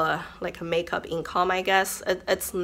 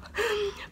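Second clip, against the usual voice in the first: short laugh; 7 dB quieter than usual. Real, not acted. Laughs at own gloom.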